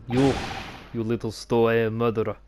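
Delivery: put-on rough Russian sailor accent. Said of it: "I like to do Russian accents".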